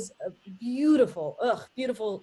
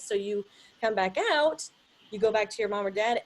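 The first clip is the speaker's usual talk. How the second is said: ordinary talk, nothing marked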